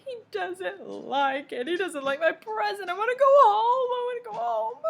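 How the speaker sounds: put-on high, wailing little-kid tantrum; crying child. A narrator's reading. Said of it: "Sam cried".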